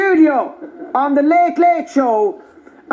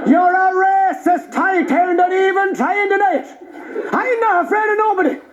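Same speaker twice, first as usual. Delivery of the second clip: put-on high-pitched, agitated Traveller accent; Irish Traveller accent; 3 semitones higher than usual. Young Traveller challenges a comedian in the street, high Traveller accent. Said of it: "two travelling young fellas".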